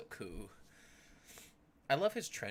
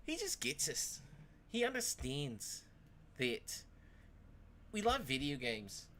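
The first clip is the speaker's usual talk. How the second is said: high gush; 5 semitones higher than usual. Real, not acted. Gushing over the creator.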